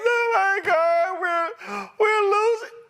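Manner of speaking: high, whiny mock wail; mock panicked farmer whining; mock crying baby. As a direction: whimper it like a crybaby farmer. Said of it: "they're crying like some babies".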